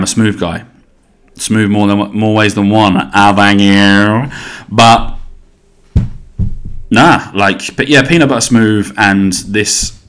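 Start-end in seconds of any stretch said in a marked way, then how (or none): none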